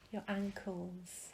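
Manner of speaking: normal talking voice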